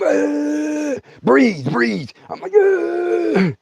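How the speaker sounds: high strained gasps between the words; gasping for breath. Retold or imitated. Burned by moonshine, gasping high-pitched for breath. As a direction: wheeze like you can't get air.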